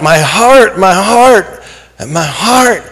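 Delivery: high, strained cry of a man on the ground; pained yelling out. Retold or imitated. Man on the ground crying out to passers-by, strained high cry. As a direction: call out in pain, pitch pushed up. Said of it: "and he's yelling out".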